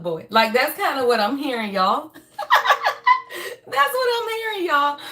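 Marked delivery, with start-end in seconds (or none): 2.4-3.6 high laugh. Real, not acted. Laughs at her own joke. 3.7-5.0 said high through laughter; laughing. Real, not acted. Still laughing after joke.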